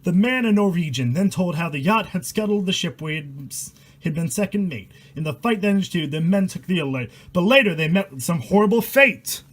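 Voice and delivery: steady rhythmic verse read aloud; poem reading. A narrator's reading. Reading a rhyming horror poem to viewers, rhythmic storytelling.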